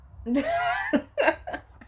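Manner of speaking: loud high laugh bursting out; burst of laughter. Real, not acted. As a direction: crack up at your own joke. Laughing at her own joke.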